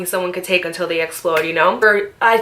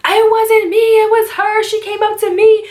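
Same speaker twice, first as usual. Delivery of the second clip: high, loud tearful wail; retold crying plea, loud and high; crying protest; 12 semitones higher, 5 dB louder than usual. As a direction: sob out the denial. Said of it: "I start crying even more".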